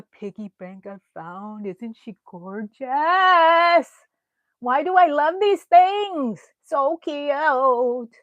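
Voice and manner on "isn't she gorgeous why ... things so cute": higher, louder, slow gushing over a find; delighted gushing. Real, not acted. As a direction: gush over something adorable. Admiring thrift finds.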